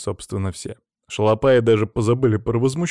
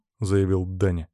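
ordinary delivery

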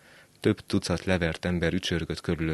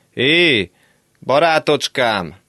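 high, loud, slow call after someone; old man shouting down the road; 10 semitones higher, 12 dB louder, slower than usual. Acted. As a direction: call after a walking-away boy. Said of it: "kiáltott utánam az öreg".

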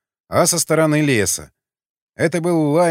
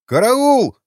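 much higher and louder shriek for help; panicked yell; 11 semitones higher, 7 dB louder than usual. A narrator's reading. The guard cries for help, fleeing underground in panic. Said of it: "завопил тот".

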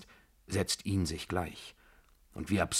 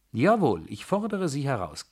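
high, emphatic retort; defiant challenge; 6 semitones higher, 4 dB louder than usual. Acted. She dares him to go to the baroness, defiant. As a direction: throw the dare back forcefully.

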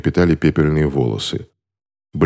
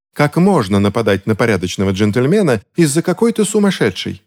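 high-pitched outraged lady; indignant; 7 semitones higher than usual. A narrator's reading. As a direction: ask it in rising outrage. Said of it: "возмущённо спросила дама в шляпке клош".

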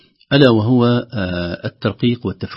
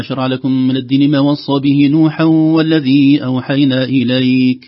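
measured chant, raised above talking pitch; Quran recitation; 4 semitones higher, 5 dB louder than usual. Shown as a demonstration. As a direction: recite it on a sustained, higher tone. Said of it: "لو قرأنا". (تلاوة مرتلة)

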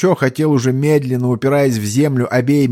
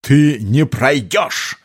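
slow hissing alien voice; hissing strange voice; 3 semitones higher, much slower than usual. A narrator's reading. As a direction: hiss it like a monster. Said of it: "прошипел незнакомый голос". (шипящий чужой голос)

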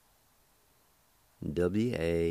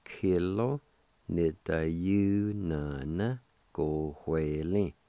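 slow demo word; much slower than usual. Shown as a demonstration. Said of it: "And all together".

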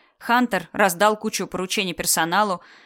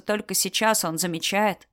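ordinary delivery, nothing marked

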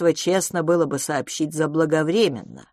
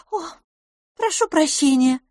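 higher, timid apology; 12 semitones higher, 3 dB louder than usual. A narrator's reading. The rebuked girl apologises again, timid and higher. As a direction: apologise meekly in a raised voice. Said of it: "снова робко сказала она".

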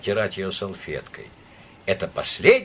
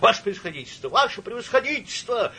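high, anxious cry, rising on the repeat; urgent, imploring exclamation; alarmed calling out; 11 semitones higher than usual. A narrator's reading. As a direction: call out to a superior in alarm. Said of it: "кричал Псилдонимов".

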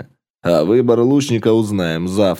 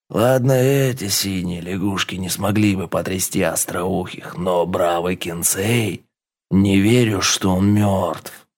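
slow, scornful naga chief; drawn-out words of a doubting chieftain; serpent chief voice; 3 semitones lower than usual. Acted. Chief Raga's voice.

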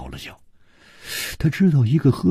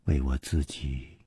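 low, soft, deliberate answer; slow admission; 6 semitones lower, 10 dB quieter than usual. A narrator's reading. Liu answering why she would kill, slowly. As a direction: let it out quietly, unhurried. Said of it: "缓缓地说".

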